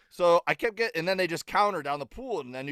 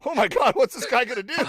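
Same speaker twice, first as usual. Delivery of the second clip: higher and louder, half-laughing mock fear; laughing panic; 10 semitones higher, 9 dB louder than usual. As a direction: blurt it out in comic alarm. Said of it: "And I go".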